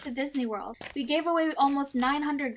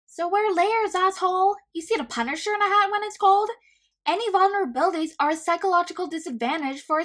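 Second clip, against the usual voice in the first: scolding; 5 semitones higher, 6 dB louder than usual. Acted. Mindy scolding Kick-Ass.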